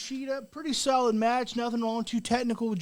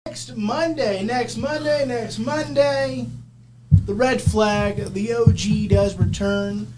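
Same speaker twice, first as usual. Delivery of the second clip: promo hype voice; 5 dB louder, slower than usual. Acted. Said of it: "promo build-up".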